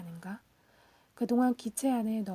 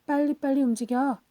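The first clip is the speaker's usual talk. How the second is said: louder, urgent order to hurry; narrated shout, raised but controlled; foreman's shout; 3 semitones higher, 7 dB louder than usual. A narrator's reading. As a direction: call it out like a foreman rushing workers. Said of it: "감독이 일을 빨리 하라고 우리에게 고함을".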